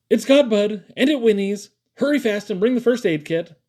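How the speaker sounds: high, loud, urgent cry; Caleb. A narrator's reading. Caleb urging his parents to hurry, shouting.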